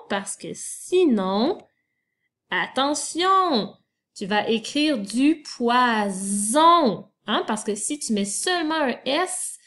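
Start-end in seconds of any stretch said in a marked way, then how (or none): 2.5-3.8 "attention" stretched slowly and pitched high; emphatic warning. Shown as a demonstration. Warns pupil of spelling trap. 5.4-7.1 "poison" slow, with a buzzing Z in the middle; S turned to Z. Shown as a demonstration. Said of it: "le S va se transformer".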